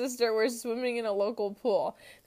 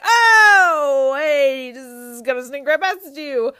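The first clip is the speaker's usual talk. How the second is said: loud, high, drawn-out oh, easing down; acted awkward recovery; 5 semitones higher, 12 dB louder, slower than usual. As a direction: play covering up an embarrassing mix-up. Covering mistaken-identity blunder, acted.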